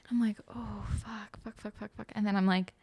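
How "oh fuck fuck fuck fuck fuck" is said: very soft, repeated cursing; hushed panicky aside to yourself; retold inner panic. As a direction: mutter it under your breath.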